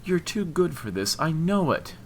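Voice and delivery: high, insistent plea; voice rising. A narrator's reading. Woman appealing to his better side, voice rising. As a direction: let the pitch climb as you urge him. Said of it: "her voice rising".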